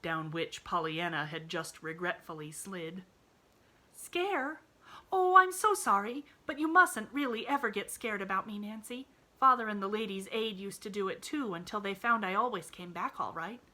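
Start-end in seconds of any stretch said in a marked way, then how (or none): 3.9-13.6 put-on bright, high little girl voice; eager child reassuring her friend; cheerful little girl. A narrator's reading. Pollyanna voiced in story.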